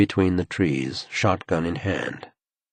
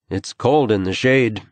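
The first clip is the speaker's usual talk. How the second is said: slightly raised, higher voice; a little louder; 5 semitones higher, 5 dB louder than usual. A narrator's reading. Man repeating himself to the woman, a little louder. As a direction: lift the voice to be heard. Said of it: "a little louder this time".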